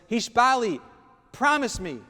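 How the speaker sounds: loud, pressing call for a promise; urgent demand. Acted. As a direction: demand the vow loudly. Executioner demands a vow.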